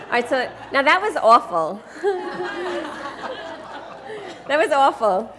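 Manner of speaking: laughing while she speaks. Real, not acted. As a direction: laugh through the words. Laughs at volunteer's attempt.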